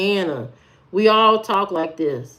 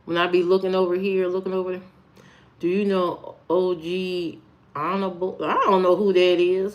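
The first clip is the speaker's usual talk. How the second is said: usual speaking voice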